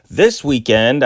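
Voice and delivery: ordinary talk, nothing marked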